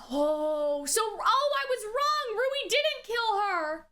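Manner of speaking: ordinary delivery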